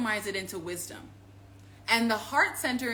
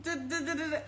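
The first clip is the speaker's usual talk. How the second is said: higher, put-on pleading voice; mock begging God, trailing into da da; mock prayer; 7 semitones higher than usual. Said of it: "it's not like I was like".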